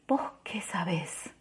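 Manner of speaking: slow unvoiced whisper, turned away; hushed defiant whisper. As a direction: breathe it out, defensive. Woman refusing, whispered reply.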